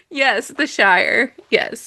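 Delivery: usual speaking voice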